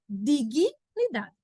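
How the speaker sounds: "Dignidade" slowly as di-gui, pause, nidade; extra i sound. Shown as a demonstration. Teacher showing the i heard only in speech, slow split word. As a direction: linger on an added i after the g in "Dignidade". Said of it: "Parece que tem um izinho aqui".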